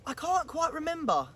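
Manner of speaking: lightly voiced gruffalo trying to recall; mildly gruff father monster. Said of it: "the Gruffalo said".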